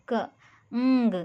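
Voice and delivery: "क,ंग" drawn out slowly and loud; teacher demonstrating the ng blend slowly; ng sound. Shown as a demonstration. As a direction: stretch the nasal "ंग" sound out. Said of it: "एन और जी मिला के अंग करते हैं".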